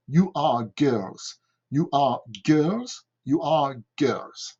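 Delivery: put-on "you are girls" said slowly and distinctly; slow it down so pupils can copy; slow model repetition. Shown as a demonstration. Teacher repeats the sentence slowly for the class. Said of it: "répéter trois fois".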